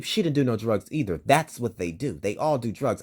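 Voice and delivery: ordinary delivery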